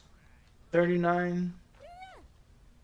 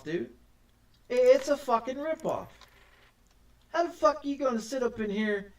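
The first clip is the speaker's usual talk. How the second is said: normal talking voice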